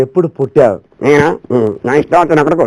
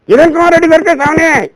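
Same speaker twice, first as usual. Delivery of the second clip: dummy voice; 13 semitones higher, 3 dB louder than usual. Acted. Ventriloquist dummy answers.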